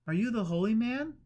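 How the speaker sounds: Eleanor. A narrator's reading. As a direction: apologise for intruding.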